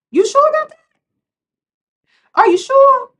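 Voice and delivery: high, loud skeptical challenge; mock disbelief; sassy doubt. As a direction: raise the pitch and dare them to believe it.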